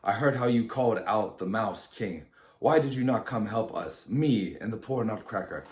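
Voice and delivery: usual speaking voice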